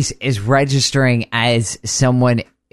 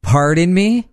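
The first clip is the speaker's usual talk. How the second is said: higher, questioning mock surprise; a touch of polite disbelief, pitched up; taken aback; 5 semitones higher than usual. Co-host as customer service reacting to the caller, mildly taken aback. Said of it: "I would go".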